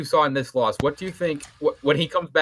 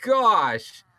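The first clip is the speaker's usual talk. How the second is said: much higher, slow, stretched-out shout; excited commentator; 9 semitones higher, much slower than usual. Acted. Commentator reacting to a wild missed punch, high excited shout. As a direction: gasp it out as the big punch misses.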